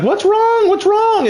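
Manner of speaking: high, anxious mom voice asking twice; worried mom. Retold or imitated.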